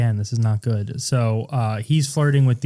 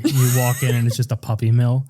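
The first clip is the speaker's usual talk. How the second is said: normal talking voice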